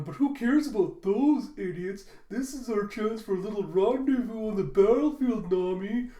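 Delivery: high, loud, swooning invitation; lovestruck suitor. Acted. Suitor asking Nami for a battlefield rendezvous, swooning. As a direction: gush with hammed-up ardour. Lecherous character acted out courting Nami.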